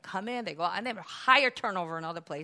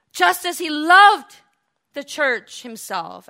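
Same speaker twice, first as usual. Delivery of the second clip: impassioned preaching; 4 semitones higher, 11 dB louder than usual. Real, not acted. Preacher to congregation on God's love, impassioned. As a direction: build to a loud, high preacher's cry.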